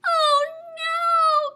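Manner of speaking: high, loud alarmed cry; cried out. Acted. Child listening to the story, crying out high. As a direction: gasp it out in fright. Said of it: "cried Penelope".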